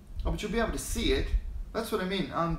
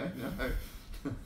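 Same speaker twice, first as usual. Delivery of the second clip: usual speaking voice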